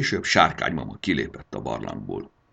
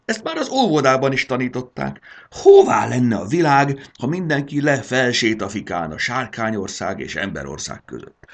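higher, louder furious dragon mother; a furious dragon mother berating her son; angry scolding; 10 semitones higher, 8 dB louder than usual. Acted. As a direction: tell him off in outrage.

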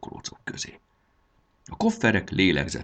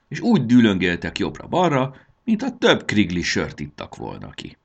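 plain reading voice

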